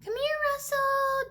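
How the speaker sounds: high, loud sing-song call to a dog. Retold or imitated. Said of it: "Just like that. That's how I used to call him".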